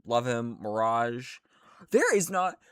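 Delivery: usual speaking voice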